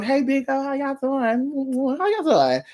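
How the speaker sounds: high, slow sing-song hello; high drawn-out put-on hello; mock greeting. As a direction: stretch out a playful mock greeting.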